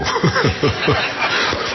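airy unvoiced laugh; breathy laugh. Real, not acted. Laughs at the anecdote.

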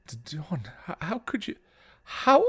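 high, faltering stammer; halting disbelief bursting out at the end; shocked and horrified. A narrator's reading. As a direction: stammer it out in horror. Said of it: "shocked and horrified".

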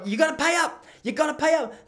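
high, loud insistent demand; demanding payout. Retold or imitated. Uncle demanding the casino pay him, loud and high. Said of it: "He started going".

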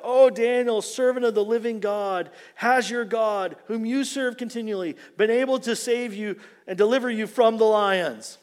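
calling out high and loud to Daniel; king calling into the lions' den, loud and pleading; yelled cry. Retold or imitated. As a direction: cry out to Daniel in the pit, full of dread. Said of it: "he yells out".

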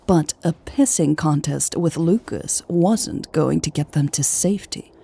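ordinary delivery, nothing marked

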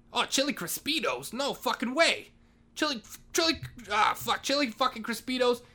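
light up at the menu; delighted surprise. Real, not acted. Janitor spotting chili Crispitos, delighted surprise.

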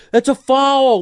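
high mocking whine; whiny foul call. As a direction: mimic a whiny player crying foul. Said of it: "you don't have to whine about it".

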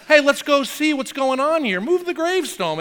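high, cheerful, offhand invitation; casual mock Jesus. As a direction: breezily suggest a look inside. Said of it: "Jesus is like".

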